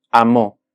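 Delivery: amó with the weight on mó; amó said as a-MÓ, second syllable strongest; stress on last syllable. Shown as a demonstration. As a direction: punch the final ó of amó. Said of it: "en la última sílaba".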